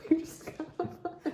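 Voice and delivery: laughing. Real, not acted. Just won the auction.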